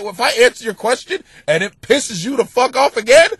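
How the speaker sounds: ordinary talk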